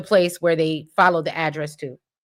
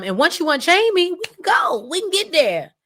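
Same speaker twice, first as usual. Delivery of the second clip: high, urgent mock plea; acted-out begging, pitched way up; imitated pleading; 10 semitones higher than usual. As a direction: plead in a raised voice. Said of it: "Chelsea is trying to plead with Allison".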